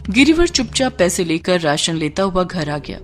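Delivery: plain reading voice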